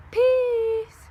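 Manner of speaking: high, drawn-out singsong catchphrase; cheesy outro voice to end the vlog; goofy sign-off. Acted. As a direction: stretch it out playfully, way up high.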